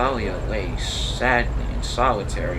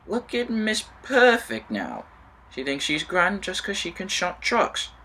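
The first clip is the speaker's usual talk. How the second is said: sneering; 6 semitones higher than usual. Acted. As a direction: sneer at Miss Perfect. Jealous engine mocks Athalia.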